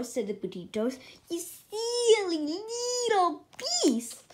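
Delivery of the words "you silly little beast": high, slow, strange taunt; spooky taunting voice. A narrator's reading. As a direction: draw it out eerily. Sisters scolding in spooky voice.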